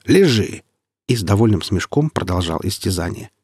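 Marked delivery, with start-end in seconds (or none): 0.0-0.6 higher, raised-voice command; scolding shout. A narrator's reading. Warrior to squirming patient, scolding shout. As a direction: order him sharply to stay put. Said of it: "прикрикнул на него Вульфер".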